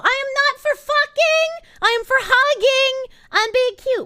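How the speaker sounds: high, loud indignant yelling; playful furious mascot; mock outrage. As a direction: protest shrilly at the creeps.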